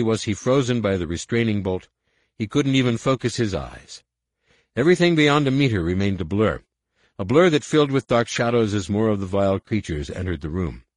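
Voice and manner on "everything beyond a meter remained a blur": ordinary delivery, nothing marked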